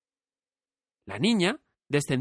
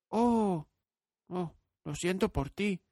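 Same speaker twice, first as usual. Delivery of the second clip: higher, softer, tender pity; sympathetic girl; 7 semitones higher, 4 dB quieter, slower than usual. A narrator's reading. Dorothy feels sorry for the brainless scarecrow. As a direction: comfort him kindly.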